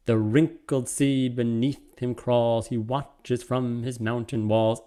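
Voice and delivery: slow, even iambic beat; slow scansion. Shown as a demonstration. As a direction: read it out beat by beat, slowly. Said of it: "being iambic".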